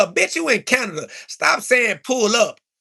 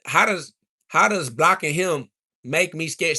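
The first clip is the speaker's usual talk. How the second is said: ordinary talk, nothing marked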